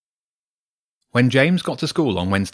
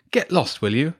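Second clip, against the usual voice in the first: angry; 7 semitones higher than usual. Acted. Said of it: "he said angrily".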